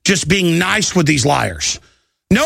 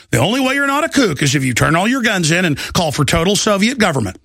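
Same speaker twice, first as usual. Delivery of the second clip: normal talking voice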